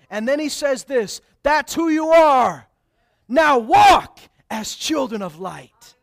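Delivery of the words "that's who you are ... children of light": slow, loud proclaiming; preaching shout. Acted. Preacher shouting the verse at the congregation, slow and loud. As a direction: hammer the verse home slowly.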